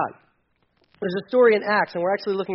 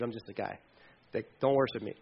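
much softer and lower, modest; sheepish aside; 9 semitones lower, 10 dB quieter than usual. Retold or imitated. Peter brushing off Cornelius's worship, soft and sheepish. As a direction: play it down bashfully.

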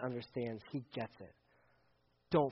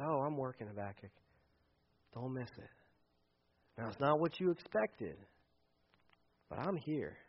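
soft, lower and reassuring; tender God; 3 semitones lower, 7 dB quieter than usual. Pretend. God reassures Habakkuk, soft and tender. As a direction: gently comfort a hurting friend.

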